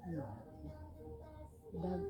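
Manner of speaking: usual speaking voice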